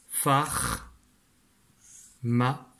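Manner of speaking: separate, held dictation syllables. Shown as a demonstration. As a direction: leave a gap between syllables for writing. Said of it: "je te donne la dernière syllabe". (syllabes dictées une à une)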